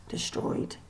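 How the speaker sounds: a hushed, dramatic whisper; whispered in horror. A narrator's reading. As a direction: breathe the word like a dark secret. Whispered dread word.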